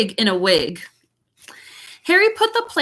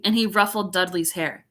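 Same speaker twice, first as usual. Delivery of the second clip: plain reading voice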